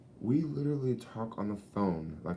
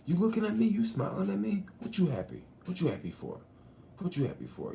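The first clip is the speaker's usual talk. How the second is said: confrontational city guy, starting high and dropping; mock a hostile stranger; imitating a street tough; 12 semitones higher than usual. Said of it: "motherfuckers be like".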